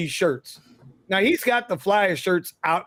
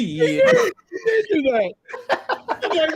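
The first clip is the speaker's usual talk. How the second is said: high, slow, laughing through the words. Real, not acted. Hearing the kid cussing story, laughing high. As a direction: crack up while agreeing.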